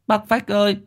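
very high, tearful pleading; crying child. A narrator's reading. Little boy begs neighbour to save his father, crying. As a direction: burst into tears and beg. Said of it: "nó đã òa khóc".

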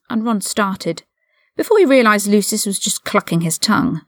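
ordinary delivery

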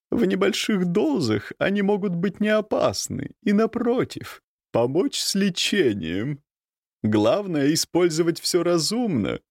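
a knowing, calm countess. A narrator's reading. Countess voiced in story.

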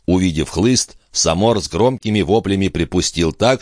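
plain reading voice

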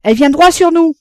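high, loud lookout's cry; excited shouting. A narrator's reading. Father in the small boat as the liner nears, shouting. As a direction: shout the news to everyone aboard. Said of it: "criait toujours Roland".

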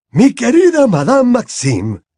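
Dumbledore voiced.

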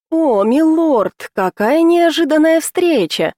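put-on high, chirping girly voice; cartoon princess. A narrator's reading. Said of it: "прощебетала".

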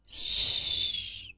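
a soft breathy shushing hiss; voiceless hushing sound; whispered shush. A narrator's reading. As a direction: hush him with a quiet sss. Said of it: "suttogott Szonya".

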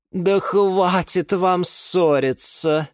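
slow, high, weary brush-off; drawn-out, exasperated plea; dismissive. A narrator's reading. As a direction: wave the squabblers away. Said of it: "отмахнулась от них Лиана Диана".

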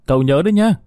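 gọi vọng lại; calling back. A narrator's reading. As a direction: call it back while running off. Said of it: "nói vọng lại".

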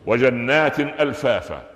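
slow recited verse; Quran recitation. Shown as a demonstration. Teacher reciting the verse to students, slow. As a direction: intone the verse slowly like recitation. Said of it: "هذه الجنات".

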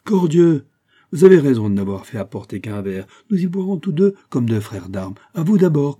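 high, loud burst of joviality; hearty exclamation. A narrator's reading. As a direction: boom out a hearty toast. Jovial duke toasting his guest.

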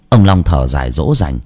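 usual speaking voice

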